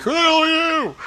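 high-pitched, hammed-up disbelief; mock bewilderment. Mocking Harry fooled by a fake beard, squeaky bafflement. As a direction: act out a baffled squeal of non-recognition. Acting out Harry not recognizing Dumbledore.

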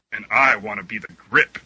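ordinary talk, nothing marked

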